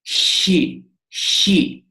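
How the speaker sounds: 'She' begun by blowing at the back of the palate; blown palatal chi. Shown as a demonstration. Greek teacher demonstrates how to make chi, blown at the palate. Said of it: "press the lower part of your palate".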